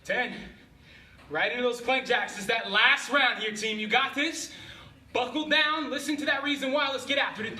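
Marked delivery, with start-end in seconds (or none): 1.3-7.6 loud hype. Real, not acted. Coach hyping final round.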